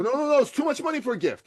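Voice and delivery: mock-flustered refusal of too much money; protesting. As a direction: refuse the gift in a rush. Borrower protesting gift.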